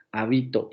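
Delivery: "habito" stressed on BI, as ha-BI-to; stress on middle syllable. Shown as a demonstration. Teacher contrasting words with identical phonemes, "habito" stressed on BI. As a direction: penultimate stress in "habito", sounding ha-BI-to. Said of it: "cae en la penúltima sílaba".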